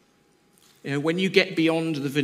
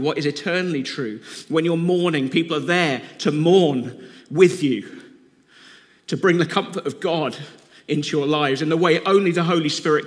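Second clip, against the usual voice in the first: louder, rising sermon climb with punched phrases; full-force preaching; 3 semitones higher, 5 dB louder than usual. Real, not acted. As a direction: preach it out to the whole room, building.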